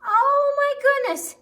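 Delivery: very high, loud gasp of surprise; loud and high, astonished; shocked loud exclamation. Acted. Exclaiming in the story.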